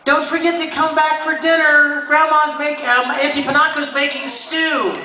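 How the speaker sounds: put-on high, bright girl calling from afar; Winry calling out. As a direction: call out high like young Winry. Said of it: "Winry yells out".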